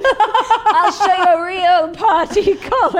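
high, loud and slow hammed-up boast; showing off for Colin, laughing; giddy mock brag; excited. Begging to host SNL.

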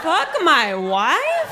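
very slow, high, swooping question; scandalised repeat. Acted. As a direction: stretch each word in mock horror. Repeats the lyric in shock.

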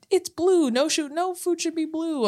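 high, preachy mock voice; a hint of a preachy fitness influencer; mocking fitness guy. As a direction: lightly imitate a lecturing fitness dude. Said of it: "I remember him being like".

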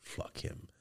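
dismissive whisper; imitating a whisper. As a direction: whisper it off like a secret.

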